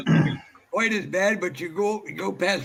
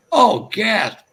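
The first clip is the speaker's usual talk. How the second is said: mock gasp; 7 semitones higher, 5 dB louder than usual. Mock gasp at Hemi fact.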